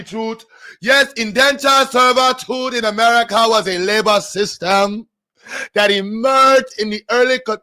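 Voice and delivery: loud, high mock African narrator reading; put-on African accent. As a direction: boom it out in a hammed-up African accent. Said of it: "full page readings and accents".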